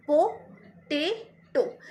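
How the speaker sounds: Potato very slowly in three pieces, po, tay, to; long pauses splitting potato into po, tay, to; syllable by syllable. Shown as a demonstration. Teacher asking students to listen to her voice, syllable by syllable. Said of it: "मेरी आवाज़ को सुनना".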